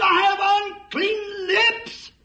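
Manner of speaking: high, slow preaching shout; screamed confession. Retold or imitated. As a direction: cry it out slowly like a broken man. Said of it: "And Isaiah screamed". (Isaiah's slow, high shout of confession)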